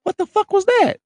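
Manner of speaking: high, startled cry of confusion. Retold or imitated. Retelling his first spasm, high startled yelp. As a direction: yelp it like the spasm just hit.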